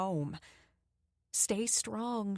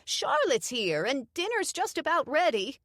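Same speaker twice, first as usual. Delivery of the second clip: put-on high, loud old-lady call; wife calls her husband to dinner; 9 semitones higher, 5 dB louder than usual. A narrator's reading. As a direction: call it toward the living room. Doreen calls to other room.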